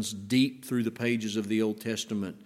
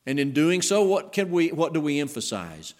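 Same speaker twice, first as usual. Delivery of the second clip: ordinary delivery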